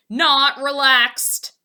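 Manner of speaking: slow, loud panicked cry; cried out. A narrator's reading. Poppleton crying out in the storm, slow and loud. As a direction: stretch it in alarm. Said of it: "Poppleton cried".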